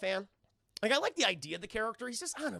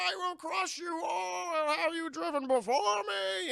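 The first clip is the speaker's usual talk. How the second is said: much higher hammed shouting villain; roaring Bane; 10 semitones higher, slower than usual. Bane showing off for Woodrue, hammed-up roaring boast. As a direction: bellow it like a cartoon brute. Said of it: "And Bane's like".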